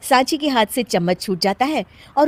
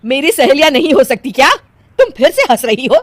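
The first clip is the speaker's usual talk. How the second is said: loud, raised offended challenge; indignant scolding; 5 semitones higher, 8 dB louder than usual. Acted. Mother-in-law to giggling daughter-in-law, indignant scolding. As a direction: tell her off for laughing.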